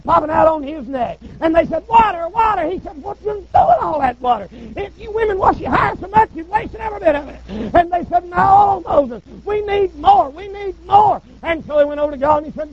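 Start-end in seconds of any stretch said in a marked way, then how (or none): 1.9-2.7 higher, whiny water water; mock nagging chorus; nagging women. 3.0-7.4 higher, annoyed retort; mock-irritated higher rant; exasperated Moses. Moses snapping at the women. 8.3-11.2 higher, raised, slowed whine; insistent church women. Acted. Women insisting on more water.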